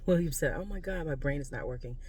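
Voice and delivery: ordinary delivery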